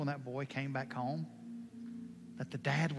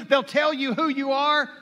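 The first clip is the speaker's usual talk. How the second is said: ordinary delivery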